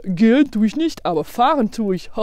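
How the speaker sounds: imitating a driver poking fun; mock the passenger's mistake, playing the driver; mocking bus driver. Bus driver teasing a foreign passenger, mocking. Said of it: "le chauffeur s'est moqué de moi".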